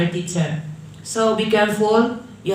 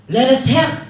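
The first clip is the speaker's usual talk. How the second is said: higher, urgent call to action; energetic exclamation; 5 semitones higher, 4 dB louder than usual. Shown as a demonstration. Teacher showing class an emergency call, energetic and urgent. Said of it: "you must have that energy".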